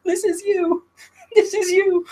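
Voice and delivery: high squeaky taunt, cracking into laughter; squeaky mocking voice. Kofi mocking the Usos with a hot dog, in a very high squeaky taunt. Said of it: "He's like".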